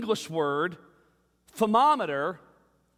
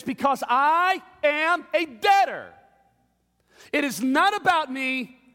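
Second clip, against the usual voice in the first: louder, higher declaration; proclaiming answer; 7 semitones higher, 4 dB louder than usual. Acted. Preacher answering the doubter with a loud, high cry. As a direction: fling the answer out.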